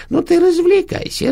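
mock the word развлекайся; sneering. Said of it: "ехидно продолжала старуха".

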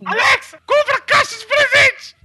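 high, loud, slow parrot squawk; the parrot trolling its owners, squawking an order at the Alexa. Retold or imitated. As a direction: stretch out the order like a cheeky parrot. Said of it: "E mandou".

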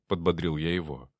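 plain reading voice

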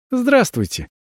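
chirpy, high sweet greeting; cartoon princess. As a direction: chirp it like a fairy-tale princess. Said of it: "прощебетала Авелла". (прощебетать как мультяшная принцесса)

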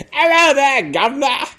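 high, loud bad Cockney greeting; bad Cockney accent. A stripper greeting the crowd in bad Cockney. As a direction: ham up a fake Cockney. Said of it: "really bad Cockney accent".

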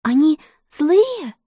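put-on slow, shaky frightened girl; trembling voice. A narrator's reading. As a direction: ask it with a trembling voice. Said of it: "дрожащим голосом спросила девочка". (дрожащим голосом)

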